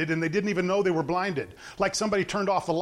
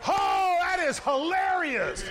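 delighted outburst; 9 semitones higher, slower than usual. Real, not acted. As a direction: burst out in amused delight. Preacher delighted by the story.

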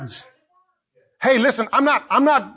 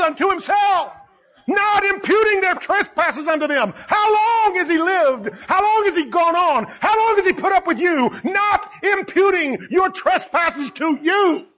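preaching at full force; 8 semitones higher than usual. Real, not acted. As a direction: drive the question home to the congregation. Preaching climax.

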